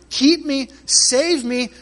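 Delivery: urgent plea. Acted.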